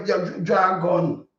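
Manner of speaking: ordinary delivery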